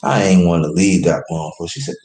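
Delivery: ordinary delivery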